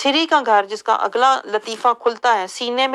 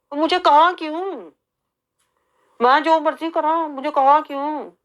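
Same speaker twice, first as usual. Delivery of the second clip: petulant mock outrage; 5 semitones higher than usual. Angry sulking reply mocked.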